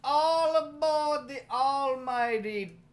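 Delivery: high, loud, slow conductor's call; train conductor call. Acted. Host calls everyone aboard for the search, loud conductor voice. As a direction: sing out all aboard like a conductor.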